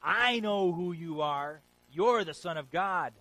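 loud, slow, drawn-out cry; demon shouting. Retold or imitated. Demon crying out at Jesus, loud and slow. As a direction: bellow it like a possessed man. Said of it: "the demon shouts out".

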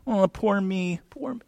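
mock a self-pitying victim; whining "poor me" in a small high voice; whiny self-pity. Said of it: "we like to lick our wounds as victims".

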